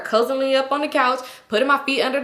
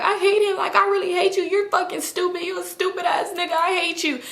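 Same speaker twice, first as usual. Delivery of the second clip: higher, mock angry insults; ranting friend; 8 semitones higher than usual. Leslie insulting the man to his face, mock angry, higher. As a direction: act out her telling him off.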